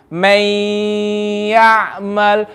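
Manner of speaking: MAY YA'MAL with a long nasal hum after MAY; with gunna. Shown as a demonstration. Teacher shows the class the difference, held nasal gunna. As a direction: hold may very long through the nose before ya'mal. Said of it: "I'm reading it extra longer".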